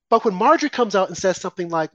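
ordinary talk, nothing marked

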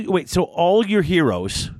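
ordinary delivery

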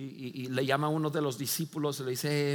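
ordinary talk, nothing marked

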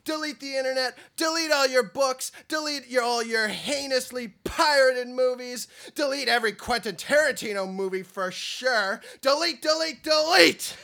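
hammed-up tough-guy announcer; macho rant. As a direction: growl the warning like an action trailer. Mock macho content warning.

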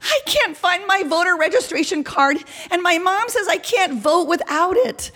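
put-on high-pitched, fretting girl; distressed girl. Girl frets to canvasser about lost card, high distressed voice. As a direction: wail it lightly in a high girl's voice. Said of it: "the girl who said she would be right down".

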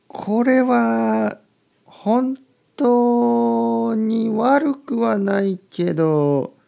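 very slow, high and loud, stretching each vowel; drawn-out hedging. Real, not acted. Teacher judges reading instead of listening, drawn-out reluctant verdict. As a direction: hold every syllable long on one high note.

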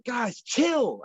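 hammed-up alarmed guys chill; urgent protest. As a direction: stop them before they cut. Telling doctors to stop.

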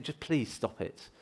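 low, hushed plea to stop; soft, annoyed telling-off; shushing. Acted. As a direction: quietly shush someone who is being too noisy. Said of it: "quite a few people shushing him".

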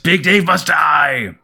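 ranting villain shout. Acted. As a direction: bellow it like a comic villain. Villain's declaration from the comic.

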